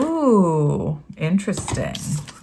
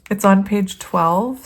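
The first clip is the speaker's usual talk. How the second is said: usual speaking voice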